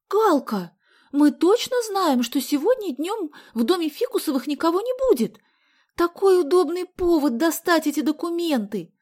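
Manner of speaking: high, loud wheedling whine; whining plea. A narrator's reading. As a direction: whine and plead like an eager girl. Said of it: "законючила Соня".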